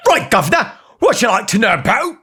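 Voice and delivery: put-on Cockney accent. Pretend Siri answers a cookie recipe in hammed-up Cockney. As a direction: play a cheeky Cockney geezer, up high. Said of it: "a Cockney voice".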